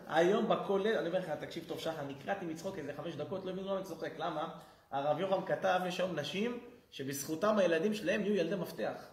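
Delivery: ordinary delivery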